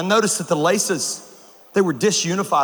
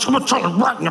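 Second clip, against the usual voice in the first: mom through clenched teeth; 3 semitones higher, much faster than usual. Retold or imitated. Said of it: "complete sentences through clenched teeth".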